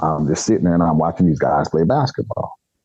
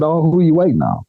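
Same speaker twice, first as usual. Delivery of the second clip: pitched-up curious stranger; curious high question; 9 semitones higher than usual. Retold or imitated. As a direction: ask it eager and high.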